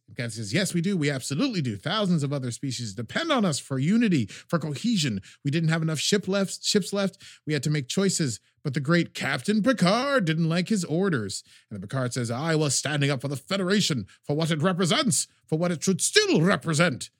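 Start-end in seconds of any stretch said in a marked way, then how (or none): 0.5-11.4 higher, a touch louder scolding admiral; mock yelling. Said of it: "yelling at each other". 12.3-17.1 higher, louder indignant defense; mock yelling. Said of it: "yelling at each other".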